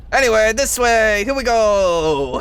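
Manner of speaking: lightly voiced snobbish devil; snobby devil voice. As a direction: lead the way with haughty impatience. Devil guide leading player on.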